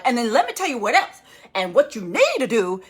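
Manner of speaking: loud, emphatic mock rant; worked-up lecturing. Doctor breaking the pep talk to lecture viewers, loud and emphatic. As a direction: launch into a forceful telling-off. Mimics self launching into a rant.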